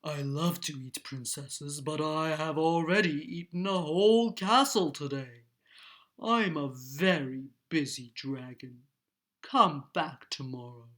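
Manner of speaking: deep, loud dragon sending someone away; low, imperious storybook dragon. A narrator's reading. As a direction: boom it out low and heavy as the dragon. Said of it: "The dragon stuck his nose out ... and said".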